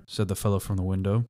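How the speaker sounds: plain reading voice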